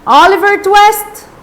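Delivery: drawn-out indignant shout, mildly acted; acted outraged cry. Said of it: "the master cried".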